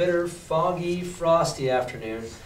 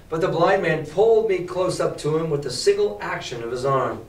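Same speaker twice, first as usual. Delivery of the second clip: normal talking voice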